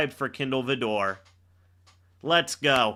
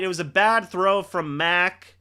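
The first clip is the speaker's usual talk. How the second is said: ordinary talk, nothing marked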